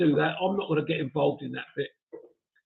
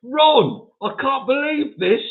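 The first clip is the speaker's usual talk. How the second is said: mock outrage; 8 semitones higher, 5 dB louder, slower than usual. Real, not acted. Mock-scolding a viewer in chat.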